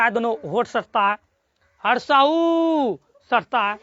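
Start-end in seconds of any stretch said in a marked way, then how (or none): 1.8-3.0 हर्षहु drawn out slowly, lips pressed together; slow lip sound demo. Shown as a demonstration. Teacher to pupils, slowly sounding a lip vowel. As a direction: stretch हर्षहु with closed lips. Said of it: "देखो बोलो".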